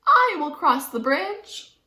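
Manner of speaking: high, slow little goat voice; a tiny brave goat offering to go first; piped up. Acted. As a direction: pipe it up bravely like the smallest goat. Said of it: "piped up".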